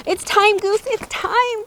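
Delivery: high, slow squawk. Acted. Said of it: "Duck squawked".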